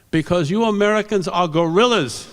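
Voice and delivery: imitated accent, high indignant scolding; Fred Eng's accent. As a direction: scold the class in a put-on foreign accent. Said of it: "do the accent, because it's part of the story".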